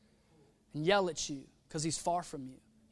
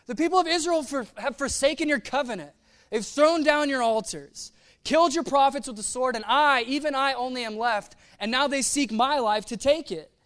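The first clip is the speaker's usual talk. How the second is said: loud, raised-pitch complaint; impassioned, higher-pitched lament; Elijah; 5 semitones higher, 7 dB louder than usual. Acted. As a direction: protest forcefully, hammering each grievance. Elijah's complaint to God, acted out.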